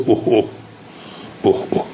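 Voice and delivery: اوه اوه as a short exclaiming cry; interjection sound. Shown as a demonstration. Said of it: "اسم صوت، مثل". (اوه اوه با لحن صوت ندا)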